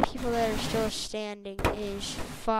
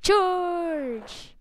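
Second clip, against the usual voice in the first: higher pretend charge yell; battle cry; 8 semitones higher, 3 dB louder than usual. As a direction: throw yourself into battle.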